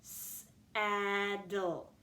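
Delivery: "Saddle" with long s and long short-a, sss-aaa-dul; stretched out. Shown as a demonstration. Teacher has pupils listen for the vowel, "Saddle" stretched out. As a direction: stretch every sound of "Saddle". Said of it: "listen for the vowel you hear".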